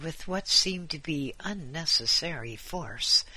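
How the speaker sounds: ordinary delivery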